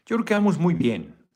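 pleased cheer. Real, not acted. Superchat total rises.

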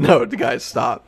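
laughing. Real, not acted. Laughing protest at friends.

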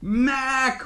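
high, drawn-out call; shout. A narrator's reading. Siblings shout after little brother heading into danger, high call. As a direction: call after someone running away. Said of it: "we both shout".

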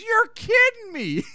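imitating childlike, excited disbelief; high, loud, drawn-out squeal of mock joy. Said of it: "He's so excited. He's like a little boy".

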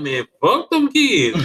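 mock exasperated snap. Husband retelling his retort to his wife, laughing mock yell. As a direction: ham up the fed-up retort, laughing. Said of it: "I'm like".

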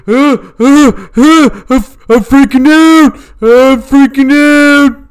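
high, loud squealing panic; mock panic. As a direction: shriek it like a man losing it. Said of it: "He's like".

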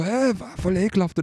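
high-pitched mock revulsion; retold outraged "eww" reaction; imitated disgust. Voices girls disgusted by the fighters.

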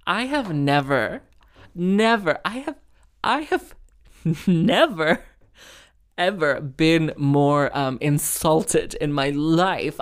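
slow, halting, hammed-up outrage; theatrically affronted, stretching out each phrase; mock indignation.

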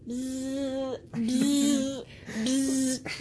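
drawn-out mocking buzz; imitated bee buzz. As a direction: buzz like a bee to mock a liar. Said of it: "what you sound like".

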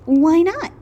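high, loud innocent question; curious Wilbur. A narrator's reading. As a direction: ask it wide-eyed. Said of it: "asked Wilbur".

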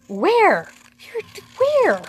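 high, loud fake astonishment; joking friend hamming up surprise; mock disbelief. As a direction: tease with exaggerated shock, voice jumping up. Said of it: "they were such jokers".

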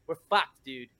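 normal talking voice

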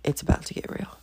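whispered teaser. Shown as a demonstration. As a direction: hush it like a secret. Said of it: "then you know".

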